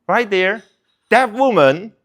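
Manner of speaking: loud, high jeering call; mocking crowd heckling an accused woman. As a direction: point and jeer at her in front of everyone. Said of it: "and they're like".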